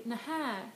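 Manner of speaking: "Nähä" with an H and a stressed, falling second syllable; last-syllable stress. Shown as a demonstration. Acknowledging a negative statement, "Nähä" stressed on the end. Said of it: "stress the last syllable instead".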